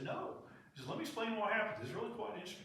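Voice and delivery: high, mock farmer explaining; lightly voiced old farmer, eager to explain; chicken farmer voice. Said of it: "the chicken farmer said".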